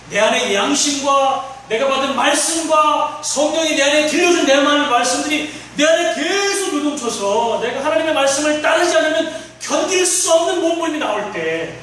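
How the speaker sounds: loud, high-pitched sermon climax; impassioned preaching. Acted. Preaches with rising force.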